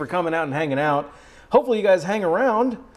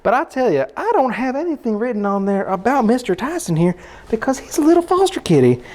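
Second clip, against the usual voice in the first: put-on Southern accent. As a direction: play a folksy North Carolina Southerner. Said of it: "this is the second accent".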